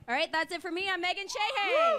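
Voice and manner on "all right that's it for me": ordinary talk, nothing marked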